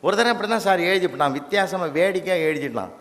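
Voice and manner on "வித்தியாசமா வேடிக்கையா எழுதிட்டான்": ordinary delivery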